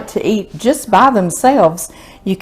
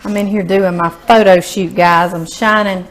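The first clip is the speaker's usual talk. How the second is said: usual speaking voice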